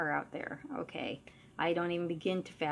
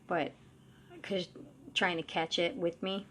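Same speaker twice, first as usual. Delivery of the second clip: usual speaking voice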